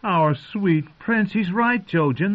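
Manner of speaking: high-pitched happy relief; delighted praise. A narrator's reading. Mira praising Bran to Jojen, delighted.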